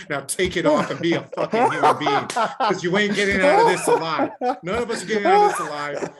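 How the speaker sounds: laughing, shouted rant at mask wearers. Real, not acted. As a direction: yell it while cracking up.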